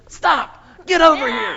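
loud, high shout right in his face; retold shout. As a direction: bellow the command, high and loud. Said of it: "she disturbed my restful sleep because she said".

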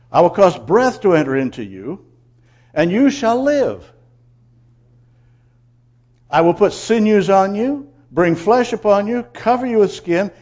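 loud, emphatic preaching of God's promise; forceful scripture proclamation. Acted. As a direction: proclaim each promise boldly.

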